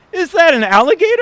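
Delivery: fast, high, loud alarm; panicked question. Acted. Actor on an elephant panicking at an alligator. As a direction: rattle it off in a panic.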